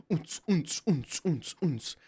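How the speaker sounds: soft, rhythmic oons-oons; imitated club beat. Man dancing at a bar with a drink, imitated club beat. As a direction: mouth a dance beat under your breath.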